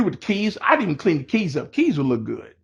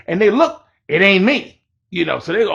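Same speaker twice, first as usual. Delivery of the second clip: ordinary talk, nothing marked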